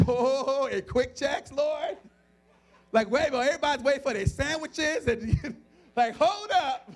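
loud, high comic disbelief; flabbergasted, half-laughing objection; incredulous protest. Retold or imitated. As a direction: protest to God in mock panic. Balks at praying in the store.

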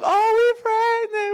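high, slow gushing mock voice; mock announcement. Fertile relatives announcing pregnancy again, high gushing mock. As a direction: ham up the lucky relatives announcing. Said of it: "every time you look".

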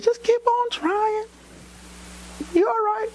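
high, slow breezy doctor voice; mock doctor. Doctor telling the couple to keep trying, high breezy voice. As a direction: play the dismissive doctor lightly. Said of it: "Doctor said".

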